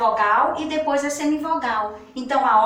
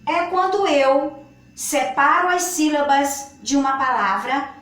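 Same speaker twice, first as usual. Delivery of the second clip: usual speaking voice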